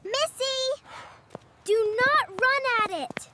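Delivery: calling out. Acted. Boy calling to his sister not to run at the dog. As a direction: call her back from the dog.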